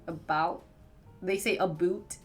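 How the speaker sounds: usual speaking voice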